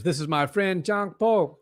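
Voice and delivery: light imitation of a Dagestani accent, John Pork rising high; Islam Makhachev's Dagestani accent. Commentator quoting Makhachev's interview in a light Dagestani accent. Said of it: "he said it in his accent".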